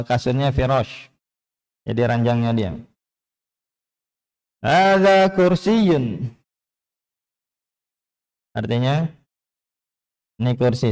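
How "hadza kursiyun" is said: "Hadza kursiyun" high and very slow, long vowels held, falling at the end; model statement intonation. Shown as a demonstration. Teacher reads for the class to follow, drawn-out statement intonation. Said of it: "Perhatikan panjang pendeknya dan intonasinya".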